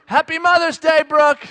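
high, loud parting holler; re-enacted hurried farewell call; called-out greeting. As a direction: shout it cheerily back toward your wife. Calling to wife while leaving.

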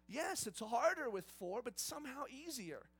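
normal talking voice

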